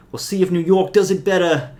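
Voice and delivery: hammed-up New York accent, playing it for laughs; a deliberately bad New York accent. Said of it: "It's a terrible, terrible accent".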